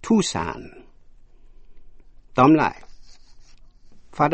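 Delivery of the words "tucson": "Tucson" said TOO-sahn, c silent; "Tucson" in two syllables, stress on TOO; Tucson said right. Shown as a demonstration. Teacher giving the Arizona city, TOO-sahn. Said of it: "đọc là".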